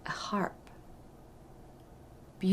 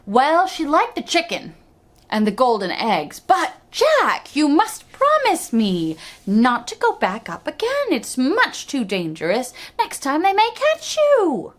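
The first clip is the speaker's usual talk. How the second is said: high, louder anxious mother; mother's voice; 7 semitones higher, 3 dB louder than usual. A narrator's reading. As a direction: warn him in a high worried mom voice. Mother warns Jack.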